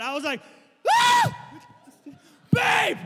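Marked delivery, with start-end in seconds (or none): 0.9-1.4 piercing, very loud startled shriek; high scream. Retold or imitated. Preacher replays a night-time scare with flashlight, high scream. Acts out scare at seeing eyes. 2.5-3.0 high, loud cry for a partner; panicked yell. Retold or imitated. Preacher reenacts calling babe after scare, panicked yell. Calls spouse in acted panic.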